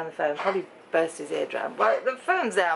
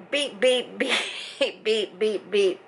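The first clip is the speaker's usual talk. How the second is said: mock censor bleep; 3 semitones higher, much slower than usual. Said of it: "A few of those words came out".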